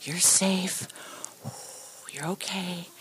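soft, hushed whisper to calm a scared animal; soothing whisper. Retold or imitated. Calming a shivering baby bunny in her hands, soft whisper. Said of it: "calm it down and say".

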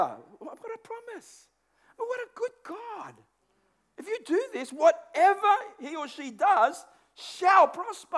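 wonder-struck praise; awed excitement. Real, not acted. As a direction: marvel at the promise. Marvelling at God's promise.

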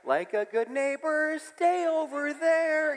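high, slow ad-jingle sing-song; neighbor's imagined thought, slow mock jingle. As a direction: sing it like the insurance ad. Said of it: "He's like".

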